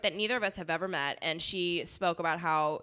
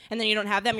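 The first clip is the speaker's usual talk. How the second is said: ordinary talk, nothing marked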